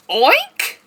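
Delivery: high, loud oink; pig oink. Pretend. Librarian oinking for kids, high and loud. Animal noise in story.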